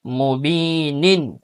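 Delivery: ordinary talk, nothing marked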